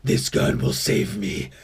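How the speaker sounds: low prepper voice sinking to a hushed, half-whispered croon over his gun; a doomsday prepper fondly showing off his rifle; off-the-grid prepper. Acted. Prepper character showing his gun to the viewers, low and doting. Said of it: "I have another guy. He's an off-the-grid guy".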